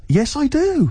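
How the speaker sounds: high, eager and flattered; flattered instant yes. Retold or imitated. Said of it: "I was so flattered".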